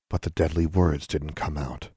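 usual speaking voice

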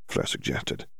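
ordinary delivery, nothing marked

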